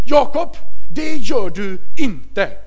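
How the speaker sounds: a hint of a wife scolding, slow; stern wife scolding. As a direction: wag a finger in a mild scolding tone. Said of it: "hytta med fingret".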